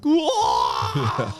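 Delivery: loud, long-held whoa; big drawn-out holler; amazed shout. Retold or imitated. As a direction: burst out in reenacted astonishment. Reenacting his instant amazed reaction.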